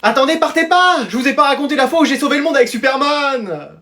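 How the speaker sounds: pleading high-pitched holler; calling after them. Acted. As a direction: call out to stop people leaving. Teacher calls after leaving students.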